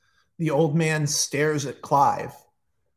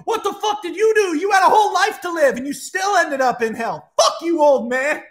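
much higher and louder, yelling in rage; furious shout; 14 semitones higher, 4 dB louder than usual. Acted. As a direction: scream it at the old man with fury. Clive yells at old guard.

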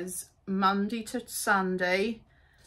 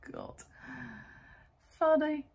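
breathy laughing God under the breath; much softer amused laugh; laughing aside; 7 semitones higher, 3 dB quieter than usual. Real, not acted. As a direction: laugh it out quietly. Laughing at own joke about London.

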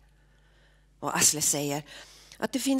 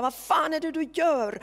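roaring; 10 semitones higher than usual. Acted. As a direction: bellow it out in pain and rage. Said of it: "Och Olve vrålar".

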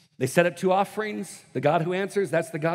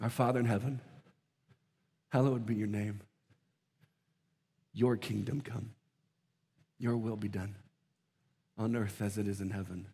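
quiet prayer; 8 semitones lower, 7 dB quieter than usual. Shown as a demonstration. Preacher modelling the Lord's Prayer for the church, softly. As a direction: pray it gently, as if alone with God. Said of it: "what if we stopped and said".

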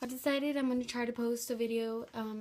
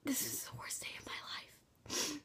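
slow, defeated whisper; despairing whisper; 3 semitones higher, 7 dB quieter, slower than usual. Real, not acted. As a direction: whisper it like it's the end. Whispered despair after vomit bean.

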